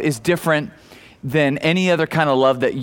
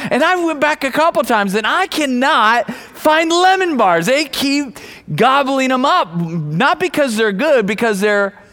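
preaching higher with mounting energy; rising preaching; 9 semitones higher than usual. Acted. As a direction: build the point, climbing. Sermon builds to a peak.

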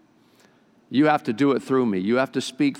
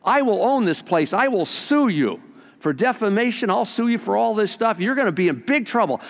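higher, indignant threat; angry customer; 7 semitones higher than usual. Acted. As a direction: tell off the shop owner.

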